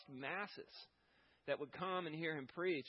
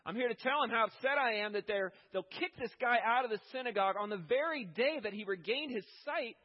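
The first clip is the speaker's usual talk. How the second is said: louder, higher, voicing God's anger at bad shepherds; indignant divine voice; 6 semitones higher, 7 dB louder than usual. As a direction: thunder it out as an upset God.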